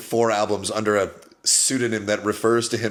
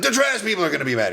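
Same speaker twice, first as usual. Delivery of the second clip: higher, fast echo of his line; 9 semitones higher, faster than usual. Retold or imitated. Repeating [name]'s line back to him, higher and fast. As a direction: parrot him back high and quick. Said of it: "I'm just repeating after him".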